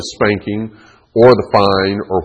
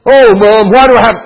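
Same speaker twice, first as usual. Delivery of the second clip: put-on high, loud voice of a protesting child; arguing boy; 13 semitones higher, 9 dB louder than usual. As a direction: argue back at Mom like a kid. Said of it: "he was just arguing with her".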